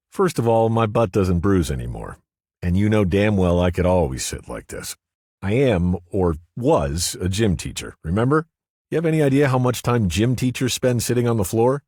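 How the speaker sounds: woman's voice, mock-offended; Cynthia, indignant. A narrator's reading. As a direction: protest it like a gym teacher scolding.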